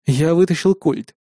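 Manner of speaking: usual speaking voice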